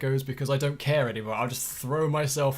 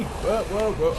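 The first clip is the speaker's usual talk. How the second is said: high rising alarmed whoa; startled whoa; 6 semitones higher, 4 dB louder, slower than usual. Real, not acted. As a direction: jump back in alarm. Startled by enemy.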